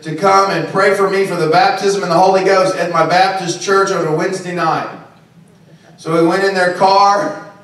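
usual speaking voice

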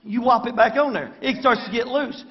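normal talking voice

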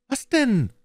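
put-on very high boy calling back; Knabenstimme. A narrator's reading. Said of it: "antwortete der Knabe". (sehr hohe Knabenstimme)

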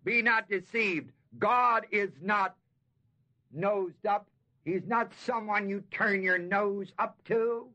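slow, weighty preaching of the verse; preaching emphasis. Acted. Preacher warns the congregation, slow and solemn. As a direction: proclaim it like a warning.

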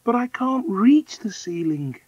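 put-on high-pitched small girl's protest; Deedy exclaiming. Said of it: "exclaimed Deedy".

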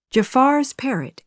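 usual speaking voice

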